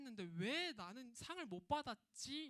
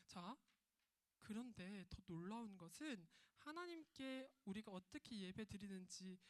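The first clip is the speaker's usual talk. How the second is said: usual speaking voice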